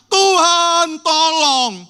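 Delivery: high, slow, drawn-out frightened plea; fearful cry for help. Acted. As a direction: beg God for rescue, terrified. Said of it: "mereka teriak".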